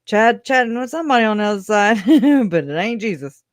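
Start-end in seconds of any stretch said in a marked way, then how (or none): 0.1-2.0 excited repeat. Real, not acted. Repeats punchline, higher, faster.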